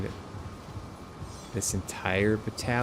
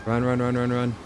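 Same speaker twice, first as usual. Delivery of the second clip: high frantic urging to run; urgent, breathless run run run; 4 semitones higher than usual. Real, not acted. Fleeing enemies in game.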